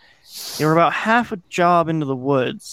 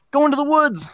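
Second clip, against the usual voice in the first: much higher pitch, held-back yell; narrated yell; 12 semitones higher than usual. A narrator's reading. Kid calling to the others in flashlight tag, yelling. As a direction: call out high, as if across yards. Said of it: "I yelled".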